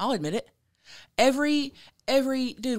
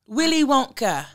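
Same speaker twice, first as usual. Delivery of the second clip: put-on playful English-accent echo, pitched up; imitated English accent; 5 semitones higher, 4 dB louder than usual. Host fondly repeats the England caller's words, mimicking her English accent. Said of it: "I love how she said".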